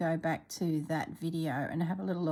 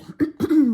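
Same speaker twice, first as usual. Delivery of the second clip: throat clearing; 9 semitones higher, 13 dB louder than usual. Real, not acted. Throat clearing mid-talk.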